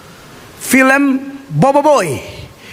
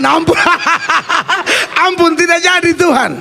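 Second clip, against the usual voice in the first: higher, laughing mock plea for mercy; 7 semitones higher than usual. Real, not acted. Preacher retelling his prayer to God, laughing mock plea. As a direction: beg pardon while laughing. Laughs while begging God's pardon.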